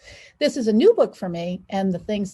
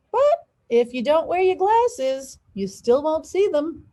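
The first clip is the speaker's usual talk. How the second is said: cheeky answer; 7 semitones higher, slower than usual. Acted. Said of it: "I answered".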